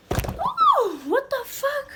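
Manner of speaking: high, loud shocked cry of disbelief; shocked outcry. Retold or imitated. Teen recalling a classmate's sudden moodiness, shocked loud outcry. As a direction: yelp it out in disbelief. Shocked outburst at friend's mood.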